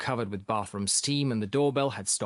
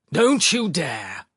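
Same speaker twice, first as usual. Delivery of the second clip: slow, raised forbidding cry; unwell exclamation; 4 semitones higher, 6 dB louder, much slower than usual. A narrator's reading. Philip forbidding Sharikov, exclaiming like a sick man. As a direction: exclaim it slowly and loudly, overwrought. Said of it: "exclaimed in the voice of a man who was clearly not well".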